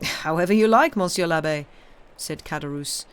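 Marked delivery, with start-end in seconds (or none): 0.0-1.6 loud, eager host's reply; obliging innkeeper. A narrator's reading. Innkeeper accepting the priest's wine order, obliging. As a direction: agree heartily to please a customer.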